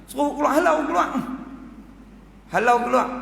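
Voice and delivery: high, loud command to throw her out; ordering her out. Acted. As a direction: bark at the guards. Menteri menghalau wanita. (menteri menjerit menyuruh pengawal menghalau wanita itu)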